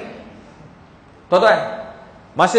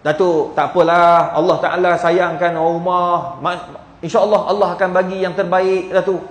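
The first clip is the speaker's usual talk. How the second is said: gentle consoling; 6 semitones lower than usual. Retold or imitated. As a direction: reassure a grieving friend, pitched low and unhurried. Consoling a grieving widower. (memujuk lembut, nada rendah)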